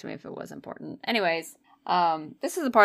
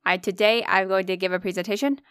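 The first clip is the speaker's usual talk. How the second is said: ordinary talk, nothing marked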